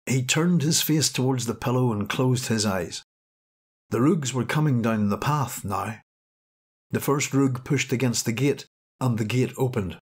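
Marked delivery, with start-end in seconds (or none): none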